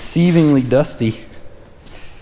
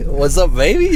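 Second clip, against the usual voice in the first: mimicking flirt; 5 semitones higher, slower than usual. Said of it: "that's how you talk to the Chipotle workers".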